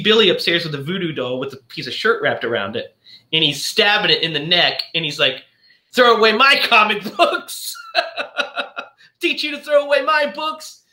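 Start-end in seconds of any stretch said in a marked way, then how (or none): 5.9-10.8 high, laughing mock child taunt; a gleeful little brat getting even; vengeful kid. Boy stabbing the voodoo doll, high gleeful taunt.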